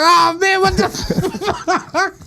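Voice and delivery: high-pitched mock yell breaking into laughter; retold scream. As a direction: imitate an exasperated scream, half laughing. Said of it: "He started screaming and shit".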